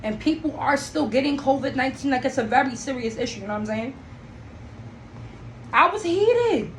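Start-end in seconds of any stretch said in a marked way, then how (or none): 5.7-6.7 heated. Real, not acted. Recalling anger at the tenant.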